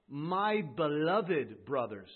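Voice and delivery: slow and deliberate, every word weighed; drawn out, tender. Shown as a demonstration. Preacher lingering on James's address to his brothers. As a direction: lean into each word slowly. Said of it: "He qualifies what he said prior".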